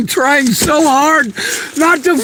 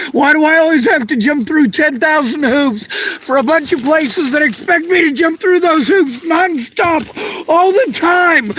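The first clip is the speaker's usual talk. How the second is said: ordinary talk, nothing marked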